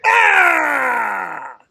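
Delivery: loud high frustrated scream; frustrated yell. Acted. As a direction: let out a loud fed-up yell. Frustrated outburst, shout.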